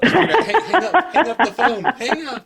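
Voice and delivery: squeaky high panicked falsetto; mock panic. Prank caller to friends, squealing high in mock panic.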